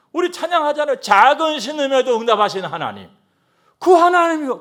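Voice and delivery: loud, driving pulpit voice; forceful sermon delivery; full-force preaching. Acted. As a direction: preach it hard to the congregation.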